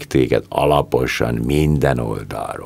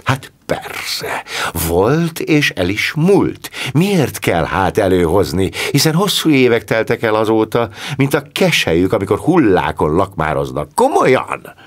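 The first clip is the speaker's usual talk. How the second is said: 1 fiery indignation; 7 semitones higher than usual. A narrator's reading. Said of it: "Clarice csak úgy szikrázott".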